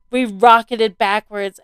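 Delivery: ordinary delivery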